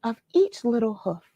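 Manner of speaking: lively read-aloud storytelling voice; story narration. A narrator's reading. Reading the Christmas poem to children on a live read-along, lively storytelling. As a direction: read it to the kids like a bedtime tale.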